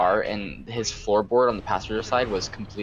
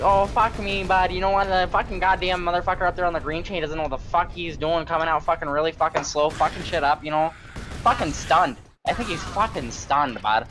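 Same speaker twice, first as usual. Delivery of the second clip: put-on thick Canadian accent; 7 semitones higher than usual. Joking with a coworker on break in a Canadian accent. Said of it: "I laid on the thick Canadian accent".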